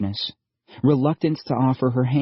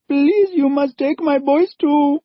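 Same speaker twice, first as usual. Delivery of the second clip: much higher, louder begging mother; calling out; 6 dB louder than usual. A narrator's reading. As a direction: call out to save her sons. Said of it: "called out Missus Chaudhry".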